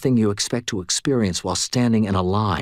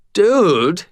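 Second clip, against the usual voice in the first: startled; 10 semitones higher, 5 dB louder than usual. A narrator's reading. Cashier startled by exploding bottles.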